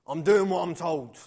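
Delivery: loud, slow, cross retort. A narrator's reading. Frowning builder answers the architect's question, crossly. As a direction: answer crossly, leaning on each word. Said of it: "the builder answered crossly".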